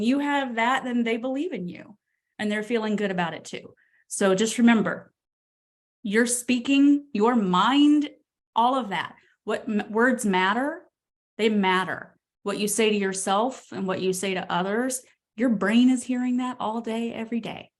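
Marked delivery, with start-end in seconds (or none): none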